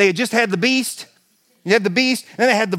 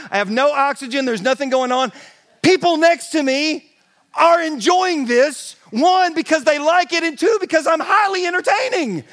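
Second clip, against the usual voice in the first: ordinary talk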